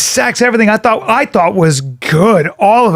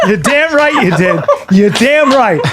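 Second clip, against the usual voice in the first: high, laughing endorsement; laughing agreement; 4 semitones higher than usual. Real, not acted. Listener cracking up at the failed break-up, laughing agreement. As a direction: laugh right through the words.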